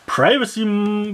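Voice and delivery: ordinary delivery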